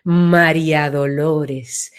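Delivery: low, slow and broad María Dolores; wide, expanded name. Shown as a demonstration. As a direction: let the name widen and settle deep. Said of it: "se ensancha".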